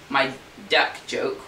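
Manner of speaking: ordinary talk, nothing marked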